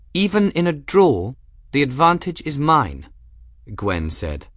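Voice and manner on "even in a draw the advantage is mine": high, loud imperious noblewoman; furious commanding lady's voice; grand hollywood dame. Acted. Gwen's imperious voice.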